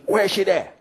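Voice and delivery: lightly voiced angry Jamaican father; Jamaican accent. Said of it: "very deep Jamaican accent".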